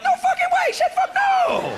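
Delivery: squealing, very high disbelief; friendly cussing. Shown as a demonstration. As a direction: shriek it in giddy amazement, stretching the end. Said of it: "Like, friendly cussing".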